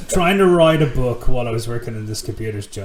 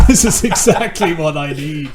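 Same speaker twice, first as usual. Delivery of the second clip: loud, higher, laughing retold relief; laughing delight; 5 semitones higher, 8 dB louder than usual. Friend recalls falling for the dingy room, laughing delight. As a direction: exclaim it through laughter, hammed-up.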